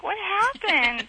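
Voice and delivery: high, slow puzzled dog voice; confused dog. Pretend. Dog voiced coming home, high slow puzzlement. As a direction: voice the dog's bewilderment, drawn out.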